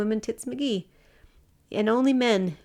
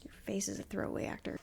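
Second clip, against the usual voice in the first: much softer, whispered teasing insult; whispered jab; 2 semitones lower, 15 dB quieter, faster than usual. Real, not acted. Teasing her co-host with a whispered insult. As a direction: slip the burn in under your breath.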